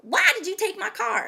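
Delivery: loud, high indignant demand; angry yell. Retold or imitated. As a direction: yell at the finance company, reenacting it. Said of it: "mad and angry, you know, to yell at somebody".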